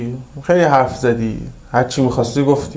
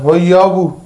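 shouted insult from up high, higher and louder; retold yell; 7 semitones higher, 5 dB louder than usual. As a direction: bark it down at the man in the street. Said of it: "از اون بالا داد زد". (داد زدن سر مرد جلوی درشکه)

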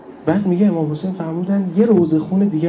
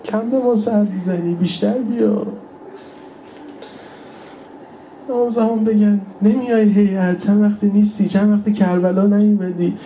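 high, pleading mourner's voice; tearful lament preaching; 6 semitones higher than usual. Real, not acted. Preacher longs for the Imam to miss him, tearful pleading. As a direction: plead high, close to weeping.

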